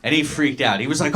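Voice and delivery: ordinary delivery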